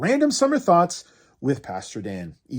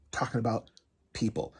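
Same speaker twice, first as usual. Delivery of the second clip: soft, low, whispery word; hushed reveal; 6 semitones lower, 9 dB quieter than usual. Acted. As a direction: let it out under your breath. Topic repeated in hushed voice.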